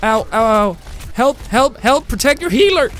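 high, loud cries for help; 1 yelling for help. Real, not acted. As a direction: panic at the team to save you. Getting killed, calling for help.

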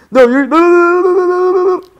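high, loud run of panicked no's; panicked cries. Real, not acted. Player watching disaster strike in a game, panicked cries. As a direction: rattle it out in rising panic.

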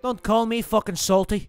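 cocky mock Irish accent, lightly done; a gamer playing brash Irish fighter Conor McGregor; swaggering put-on Irish brogue. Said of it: "My name's Conor McGregor".

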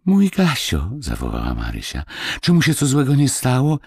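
high-pitched cry for a beloved dog; anxious exclamation. Acted. Marysia asks after her dog Gasio, anxiously exclaiming. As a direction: call out the pet's name, pitched well up.

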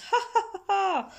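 high, acted kids' laughter; fit of giggles. A narrator's reading. Kids laughing at the teacher, giggling. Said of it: "fits of giggles from the kids".

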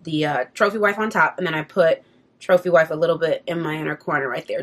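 ordinary talk

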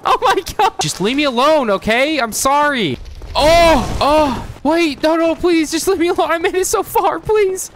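high-pitched, frantic begging; panicked pleading. Real, not acted. Player begging attackers to leave him alone, panicked high pleading.

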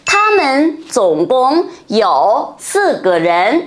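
Tha men tổng cộng you sư ge ren said slowly; slow model sentence. Shown as a demonstration. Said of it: "thêm từ tổng cộng thì có thể nói".

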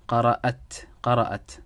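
قرأت said "qara'at", ending in "at"; she-form reading. Shown as a demonstration. Teacher models the word for Maryam, "qara'at" for قرأت. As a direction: read قرأت as three syllables, qa-ra-at. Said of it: "it has to be read as قرأت".

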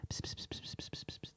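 hushed, secretive whisper; conspiratorial whisper with soft psst sounds. A narrator's reading. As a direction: lean in and whisper the plan.

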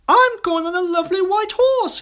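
put-on high, loud, eager child; excited child's cry. A narrator's reading. Second child choosing a ride, excited cry. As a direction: pipe up loudly in delight. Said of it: "cried the other".

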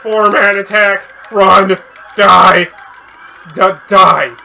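loud yelled commands; excited gamer shouting; battle shouts. Real, not acted. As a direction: yell them mid-fight. Shouting during fight.

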